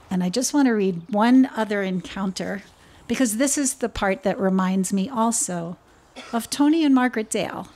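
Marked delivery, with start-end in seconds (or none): none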